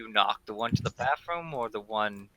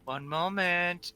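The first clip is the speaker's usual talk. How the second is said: high, slow feminine voice; drawn-out lady's voice, lightly put on; 7 semitones higher, slower than usual. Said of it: "you hear a more feminine voice".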